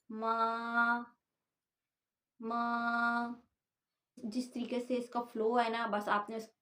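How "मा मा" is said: मा held level and flat; first tone. Shown as a demonstration. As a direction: keep मा steady on one pitch. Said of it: "को हम pronounce करते हैं". (मा सपाट पहले टोन में)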